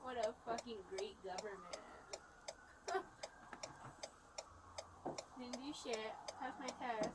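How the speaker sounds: bitter sarcastic hush, unvoiced; sarcastic whisper. Real, not acted. Arrested woman sneering about the government, whispered. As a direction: sneer it under your breath.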